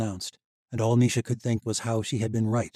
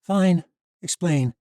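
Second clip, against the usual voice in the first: resigned; 9 semitones higher, 10 dB louder, much slower than usual. A narrator's reading. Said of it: "Misha sighed".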